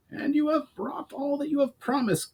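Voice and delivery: high gruff goblin voice; a suspicious goblin grunting; Brawl, grunting goblin. A narrator's reading. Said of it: "grunted Brawl".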